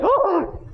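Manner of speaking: high, shocked "oh"; acted startled gasp. Preacher mimicking David spotting the bather, high gasp. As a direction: gasp as if caught off guard. Acts out David seeing the woman.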